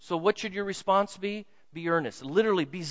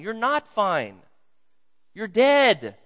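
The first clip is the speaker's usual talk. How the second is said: forceful rebuke; 5 semitones higher, 7 dB louder, much slower than usual. Real, not acted. Preacher confronting complacency.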